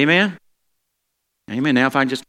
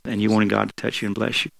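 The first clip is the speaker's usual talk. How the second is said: ordinary talk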